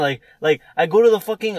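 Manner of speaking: ordinary delivery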